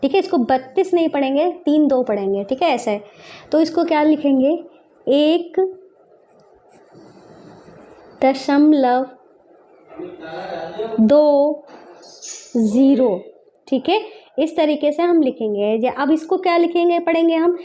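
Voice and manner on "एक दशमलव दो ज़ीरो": stretched words, long gaps between them; slow dictation. Shown as a demonstration. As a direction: dictate it one word at a time. Dictating while writing in words.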